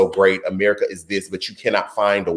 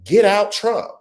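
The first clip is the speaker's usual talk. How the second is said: much higher, sassy brush-off; voters dismissing Trump; 4 semitones higher, slower than usual. Acted. Voters telling Trump to leave, sassy breakup tone. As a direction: shoo him out like a breakup. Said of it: "And we said".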